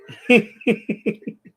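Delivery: high loud burst of laughter. Real, not acted. A host laughing at his rival, loud laugh. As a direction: crack up at your own joke.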